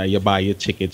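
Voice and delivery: ordinary delivery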